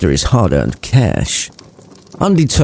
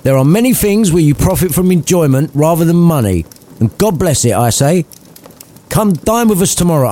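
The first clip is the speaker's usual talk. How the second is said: put-on lightly higher, earnest young man; cheerful nephew; 9 semitones higher than usual. Fred inviting his uncle to dinner, higher and earnest. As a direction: plead kindly, upbeat.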